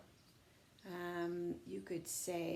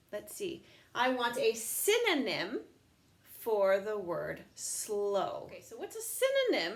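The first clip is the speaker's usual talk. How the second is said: ordinary talk, nothing marked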